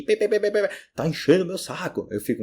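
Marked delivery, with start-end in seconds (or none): 0.0-0.7 high mock horn beeps; imitating car horns. Teacher mocks the honking cars outside with high beeps. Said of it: "esses carros agora buzinando aqui".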